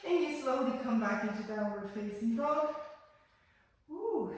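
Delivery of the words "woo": a high, playful woo of release. Real, not acted. Yoga teacher to class after a tough stretch, high woo. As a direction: let out a whoop of relief, pitched up. Comes up from an intense pose.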